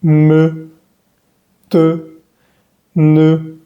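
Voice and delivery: me, te, ne with rounded "eu"; isolated, steady French syllables me, te, ne; French schwa syllables. Shown as a demonstration. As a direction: hold me, te, ne evenly, lips rounded. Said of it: "se dice se".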